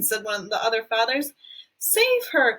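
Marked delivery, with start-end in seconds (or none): 1.8-2.6 high, desperate plea; cried. Acted. A mother crying out to save her daughter, high and desperate. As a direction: beg them to save her. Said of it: "cried Missus Beauregard".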